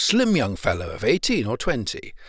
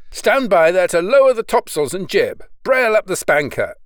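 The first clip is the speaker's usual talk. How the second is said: higher, louder deck command; shouted order; 5 semitones higher, 5 dB louder than usual. A narrator's reading. Young sailor orders sails lowered passing the tower, shouting. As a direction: shout instructions to the crew. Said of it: "the young man shouted".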